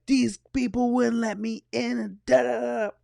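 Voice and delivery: high-pitched imitation of a swearing woman's rant; mock ranting lady. Woman who was refused the line rants, mock high-pitched whine. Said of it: "the lady's just going off".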